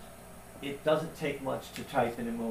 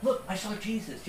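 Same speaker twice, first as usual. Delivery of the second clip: a higher, teasing mock quote; mocking the brother; 8 semitones higher than usual. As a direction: lightly mimic a sneering brother. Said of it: "It was just a mock here".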